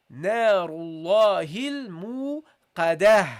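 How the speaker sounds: تلاوة مجودة; Quran recitation. Shown as a demonstration. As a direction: recite نار الله الموقدة melodically. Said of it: "that's how you pronounce it".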